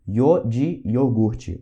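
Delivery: Yo de iogurte drawn out very slowly; slow spelling-out. Shown as a demonstration. Teacher slowly spells out Yo de iogurte. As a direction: stretch Yo de iogurte word by word.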